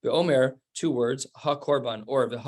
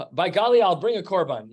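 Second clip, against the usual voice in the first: mock oath, higher and louder, swearing it with a flourish; hammed-up vow; 5 semitones higher, 5 dB louder than usual. Teacher acting out a dramatic vow for students, hammed-up exclamation. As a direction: belt out a folksy oath like making a grand promise. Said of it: "in our way would be like".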